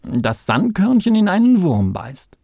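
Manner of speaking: hoch und laut beteuernd; entschlossen besorgt. A narrator's reading. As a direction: mit Nachdruck empört ausschließen. Kalle beschließt, den Apfel zu prüfen. (aufgeregter Maulwurf)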